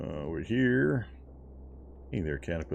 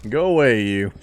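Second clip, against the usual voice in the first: shooing; 2 semitones higher, 8 dB louder than usual. Real, not acted. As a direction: shoo the monster off. Shooing a monster.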